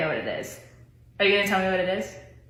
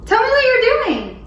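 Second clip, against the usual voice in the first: higher and louder, pressing for an answer; exasperated demand; 10 semitones higher, 4 dB louder than usual. Real, not acted. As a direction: insist he finally explain. Puzzled wife demands an answer.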